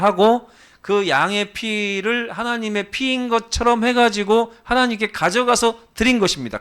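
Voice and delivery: high, driving sermon delivery; emphatic preaching. Real, not acted. Preacher explaining old sacrifices to congregation, emphatic preaching.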